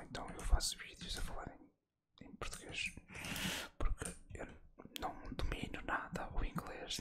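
hushed, unvoiced gaming commentary; murmured play-by-play, all in whispers; ASMR whisper. Real, not acted. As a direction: whisper close to the mic for an ASMR video.

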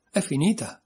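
plain reading voice

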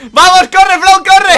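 high, loud yell to run; shouted in a rush of excitement; urgent shout. Real, not acted. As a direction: urge your teammate on at the top of your voice. Urging teammate to run.

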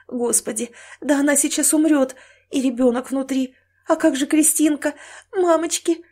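high, frightened plea; terrified woman pleading to herself; inner panic. Acted. Panicking woman's thoughts as she suffocates.

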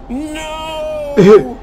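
huge high shouted no; villain's shout. Acted. Supervillain losing to an empty screen, shouting. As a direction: howl it in defeat. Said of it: "the supervillain's like".

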